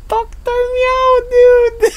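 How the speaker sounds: very high, slow squeal; squeaky cat voice. Acted. Streamer invoking Doctor Meow, squealing cat voice. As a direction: stretch it out high, swelling to a squeal.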